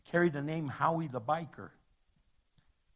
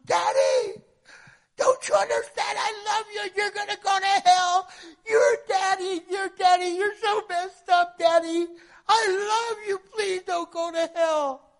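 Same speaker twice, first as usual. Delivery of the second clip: put-on high, loud, tearful child's plea; crying little girl; 9 dB louder than usual. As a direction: beg her dad like a sobbing seven-year-old. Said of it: "she goes".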